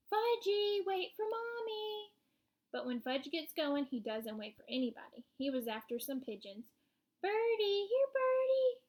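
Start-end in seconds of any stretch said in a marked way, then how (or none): 0.1-2.1 high holler, drawn out at the end; hollering mother. A narrator's reading. 7.2-8.8 put-on high, loud little-boy call. A narrator's reading. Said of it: "he called".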